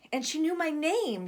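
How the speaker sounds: put-on high, breathless kid; excited little boy. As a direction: blurt it out to big brother. Boy tells brother excitedly.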